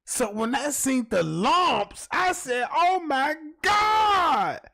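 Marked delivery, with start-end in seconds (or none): 2.7-4.6 much higher, loud, slow "Oh my God"; hammed-up shocked outburst; shocked shout. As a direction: cry out in stunned horror. Seeing the lumps in 4K.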